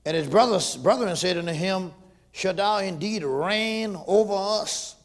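loud, slow scripture preaching. Acted. Preacher proclaiming Joseph's dream, loud and slow.

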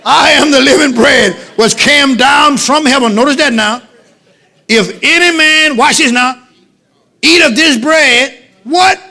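loud, slow, high scripture proclamation; full-force preaching. Real, not acted. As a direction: proclaim each phrase forcefully to the congregation.

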